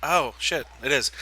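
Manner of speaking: ordinary talk, nothing marked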